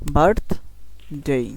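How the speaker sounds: Birthday split, with birth punched; slow birthday, strong first syllable; stress on first syllable. Shown as a demonstration. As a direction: hit birth, pause, then a lighter ডে in birthday. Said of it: "এটা একটু জোরে বলতে হবে".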